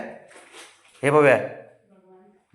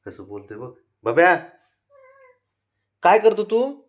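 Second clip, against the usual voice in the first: put-on high, loud coaxing baby talk to a sulking pet; 8 semitones higher, 5 dB louder than usual.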